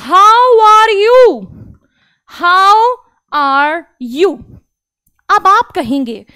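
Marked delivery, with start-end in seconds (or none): 0.1-4.4 how are you drawn out very slowly; slow drill; slow English model. Shown as a demonstration. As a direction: stretch each word of how are you. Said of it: "आप कैसे हैं".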